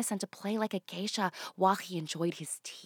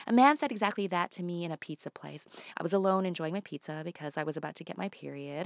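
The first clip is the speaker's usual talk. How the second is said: ordinary talk, nothing marked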